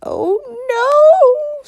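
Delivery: shrieking very high, loud; witch's shrill scream; melting witch screaming. Acted. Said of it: "screamed the evil".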